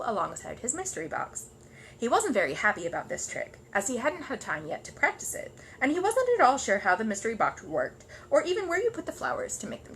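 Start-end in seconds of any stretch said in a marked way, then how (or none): none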